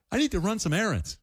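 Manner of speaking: a lightly voiced baby-talk line about errands; baby talk to a dog. Shown as a demonstration. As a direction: chirp the errands line to a pet. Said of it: "I'm terrible at this".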